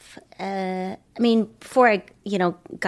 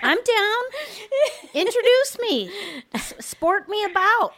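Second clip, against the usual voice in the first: high-pitched laughing giggle; 12 semitones higher than usual. Real, not acted. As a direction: laugh helplessly through it. Laughing at own dating story.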